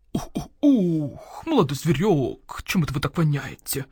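put-on hooting old owl, slow start; owl shopkeeper voice. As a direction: voice the grumpy owl merchant.

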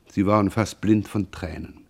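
ordinary delivery, nothing marked

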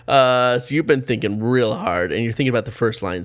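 ordinary delivery

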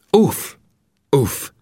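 high, sharp cry of uff; a sudden delighted outcry; exclaiming. Acted. Vinettu crying out in surprised delight. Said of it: "kiáltott fel Vinettu".

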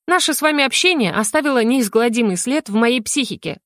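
ordinary delivery, nothing marked

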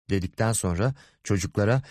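usual speaking voice

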